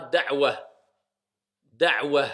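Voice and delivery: da'wa cut short on the a at the pause; pausal form. Shown as a demonstration. Teacher showing students how to stop on the word. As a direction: stop on da'wa, drop the ending. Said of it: "si on applique la règle de lecture".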